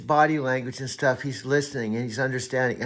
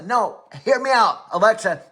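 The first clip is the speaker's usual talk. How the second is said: raised, insistent correction; exasperated; 5 semitones higher, 5 dB louder than usual. Real, not acted. Man correcting his AI, raised and exasperated. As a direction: cut in loudly to set it straight.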